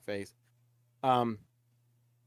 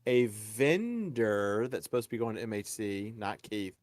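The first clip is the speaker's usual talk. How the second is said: usual speaking voice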